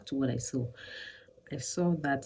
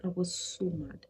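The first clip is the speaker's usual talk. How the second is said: normal talking voice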